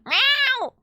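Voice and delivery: a sharp, high falling meow; one loud cat yowl. Pretend. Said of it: "like, mimic her".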